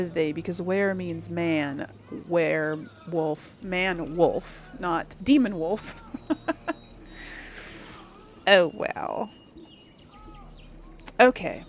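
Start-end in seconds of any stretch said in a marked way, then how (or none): 6.1-8.0 soft, breathy chuckle; amused chuckle. Real, not acted. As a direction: chuckle quietly to yourself. Amused by own wordplay remark.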